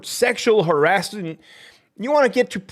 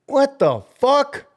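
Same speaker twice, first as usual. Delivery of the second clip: disbelief; 8 semitones higher, slower than usual. Real, not acted. Reacting to the blame.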